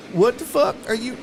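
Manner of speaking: ordinary talk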